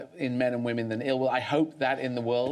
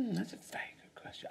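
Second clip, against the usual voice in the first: whispered stall; 7 semitones higher, 15 dB quieter, much faster than usual. Real, not acted. Stalling on a hard question.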